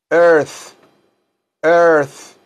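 "earth" with airy TH on the tongue; exaggerated final TH in "earth". Shown as a demonstration. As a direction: end "earth" like an F made with the tongue. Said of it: "lo digo como la letra F".

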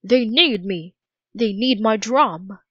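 put-on a touch of rising upset in a boy's voice; lightly voiced boy, growing upset; mild upset boy. A narrator's reading. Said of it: "He sounded more and more upset".

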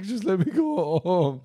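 put-on high, loud, drawn-out childish wail; hammed-up tearful plea; mock near-crying. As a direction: wail it like a desperate kid. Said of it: "And I was almost crying".

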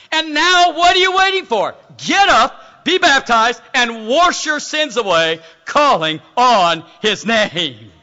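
loud, building call, slowing to the end; emphatic scripture reading. Real, not acted. Ananias urging Saul to be baptized, declaimed forcefully. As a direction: declaim it as an urgent summons.

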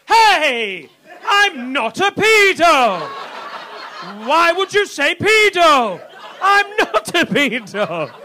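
loud, high, drawn-out shout of denial; indignant protest. Acted. Comic protesting to the street after being accused, indignant shouting. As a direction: shout it out slowly, wounded and pleading. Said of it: "reason with it and be like".

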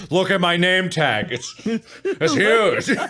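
loud, high comic outburst; mock exclamation. Showing friends the long name tag, mock exclamation. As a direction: shout it up high for laughs. Joking about very long nicknames.

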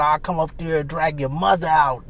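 mocking the man yelling up at the building; mock threat. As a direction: sneer it low, half-hearted fake menace. Mocking Hassan's hollered threats.

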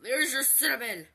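loud spiteful comeback; angry retort. Retold or imitated. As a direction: fling it back in her face. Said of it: "I'm like".

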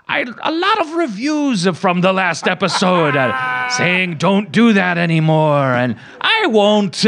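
hammed-up Norwegian host greeting; Norwegian host impression. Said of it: "he was like".

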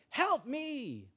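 high, drawn-out mock cry; cry for help. Someone in trouble calling to God, crying for help. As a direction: call out long and high as if in trouble. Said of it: "when you're in trouble, you, you're like".

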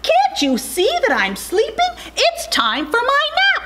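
very high little bird protest; indignant baby bird. A narrator's reading. Baby bird woken from nap, high protest. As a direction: squeal the complaint as a woken chick. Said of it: "said the Bebe bird".

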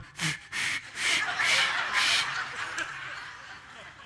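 breathy failed whistle, high; mock her hopeless whistle; airy whistle attempt imitation. Imitating wife's failed whistle at the top of the hill. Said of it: "She was at the top of the hill going".

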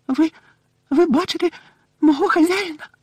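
very high, halting elf voice; tearful elf daring to hope; breathless hope. A narrator's reading. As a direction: squeak it haltingly, catching on the first word. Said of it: "затамувавши подих".

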